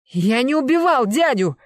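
loud, high cry of denial; panicked yell. A narrator's reading. Nephew accused of killing his uncle, panicked yell. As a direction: yell your innocence at full voice. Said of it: "завопил Кеша".

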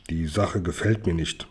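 low, soft grumble; grumbled. Acted. Dimpfelmoser to Kasperl about the ransom, low grumble. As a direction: mumble it darkly under the breath. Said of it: "brummte er".

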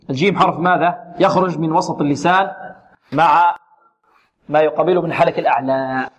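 ordinary talk, nothing marked

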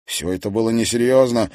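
usual speaking voice

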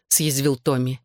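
ordinary delivery

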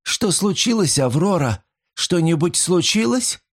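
high, anxious questioning; worried companion, pitched well up; plaintive voice. A narrator's reading. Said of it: "раздался вдруг жалобный голос".

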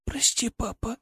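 high, hoarse apology; hoarse whisper. Acted. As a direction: rasp it out weakly. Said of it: "прохрипела Златана".

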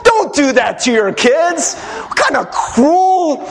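exasperated, high-pitched scolding; indignant outcry. Real, not acted. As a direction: cry out in outrage at cruel parents. Preacher scolds prank-playing parents.

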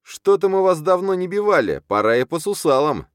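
very high, loud menace; threatening shout. A narrator's reading. Editor threatening the khan, shouting angrily. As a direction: threaten a beating at full pitch.

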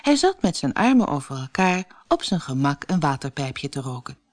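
ordinary delivery, nothing marked

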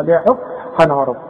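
usual speaking voice

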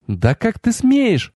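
high, slow indignant goddess; indignant outburst. A narrator's reading. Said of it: "вспылила богиня". (возмущённо, высоко и протяжно)

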